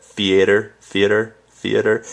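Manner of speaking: "theater" with an "ay" in the middle, said "thee-ay-ter"; own "theater". Shown as a demonstration. Showing viewers her northern "theater", drawn out. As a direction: stretch an "ay" into "theater". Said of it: "I do kinda get the A in there".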